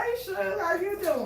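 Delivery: lightly voiced, overly friendly southern greeting; a sing-song "Hey, Suge"; a cheery country hello to a stranger; mock friendly greeting. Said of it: "walking around talking about".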